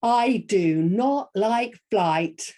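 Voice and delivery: drawn-out, emphatic story line; slow, weighty character declaration; slow and deliberate. Acted. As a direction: space out every word for suspense. Models the reluctant character's line.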